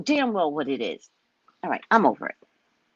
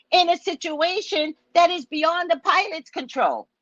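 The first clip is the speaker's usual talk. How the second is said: pitched-up angry rant; indignant rant; 9 semitones higher than usual. Acted. Vlogger raging at union officials, pitched high. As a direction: tear into them, outraged.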